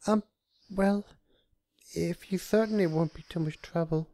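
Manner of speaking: high, hesitant voice; shy high hesitation; uncertain Fluttershy. A narrator's reading. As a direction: trail off unsure. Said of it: "Fluttershy continued uncertainly".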